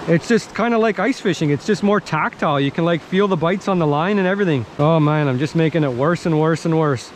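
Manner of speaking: normal talking voice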